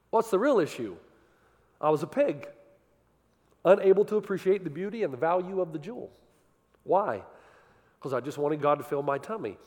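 usual speaking voice

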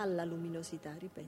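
gentle quiet correction; soft prompt. Acted. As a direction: whisper the cue to him. Prompting his reading softly.

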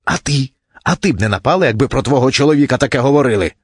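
high-pitched plaintive protest; indignant wife. A narrator's reading. Wife challenges husband after the fight, indignantly.

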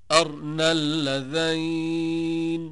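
slow chant, أرنا as "arna"; no vowel after r in أرنا, long closing syllable; silent-vowel r. Shown as a demonstration. Teacher showing four readers' variant, أرنا chanted as "arna". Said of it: "وقرأ السوسي وشعبة".

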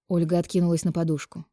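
usual speaking voice

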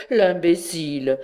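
a touch of laughter on it; chuckling at the fool; laughing scorn. A narrator's reading. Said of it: "un besoin de rire le saisit".

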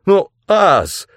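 high, loud pleading cry; wailing protest. A narrator's reading. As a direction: plead loudly in dismay. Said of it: "завопил я".

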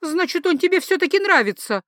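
high-voiced, jealous accusation; accusing exclamation. Jealous wife confronts her husband's colleague, high and accusing. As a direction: pounce on it as proof, pitched high.

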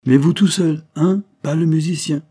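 high, loud, sudden demand; insistent condition. Acted. Suspect turns to the commissioner setting terms, loud and insistent. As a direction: turn back and insist loudly.

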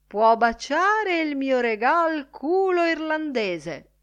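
high, loud, slow drawn-out yell. A narrator's reading. Crawford yelling his rude reply to Bloom, loud yell. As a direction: bellow the insult, stretching the words. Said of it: "urlò ad altissima voce".

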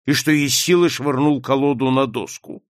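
usual speaking voice

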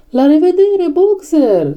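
high, drawn-out group goodbye call; chorused farewell. A narrator's reading. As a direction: call goodbye like a whole crowd. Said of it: "ziseră ele în cor". (rămas-bun strigat în cor, pe ton înalt)

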